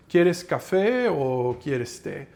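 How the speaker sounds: slow, lilting Spanish "¿Quieres café o quieres té?"; Spanish phrase, wavy. Shown as a demonstration. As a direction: let "¿Quieres café o quieres té?" roll slowly, stress on "café". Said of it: "the way I announce the words".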